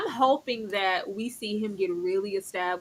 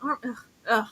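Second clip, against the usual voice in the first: normal talking voice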